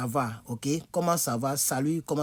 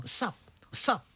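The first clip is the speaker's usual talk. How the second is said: louder, higher clipped "sap, sap"; slangy greeting; 6 semitones higher, 5 dB louder than usual. Retold or imitated. Teacher imitating slangy American greeting, higher. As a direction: fire off quick slang hellos. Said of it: "Vous voyez maintenant, non?".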